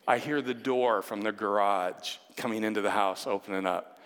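ordinary talk

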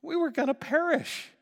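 high, loud, fast protesting disciple; voicing a disciple. A disciple excusing their fear to Jesus, high and loud in panic. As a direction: defend yourself in a panic. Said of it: "it's like".

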